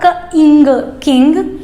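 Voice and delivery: "क, इंग" said apart and then joined as "किंग"; sound blending. Shown as a demonstration. Teacher sounding out king for kids. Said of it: "तो क्या हुआ?".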